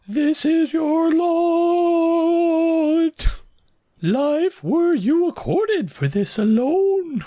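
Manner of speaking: very high, slow, wailing ghost. Shown as a demonstration. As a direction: stretch every word out like a haunting spirit. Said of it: "We're gonna do the ghost voice".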